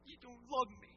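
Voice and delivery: mock pouting child to mom; sulky child. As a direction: whine like a scolded child. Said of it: "they get all this attitude saying".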